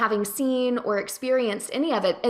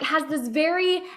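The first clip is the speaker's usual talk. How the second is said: usual speaking voice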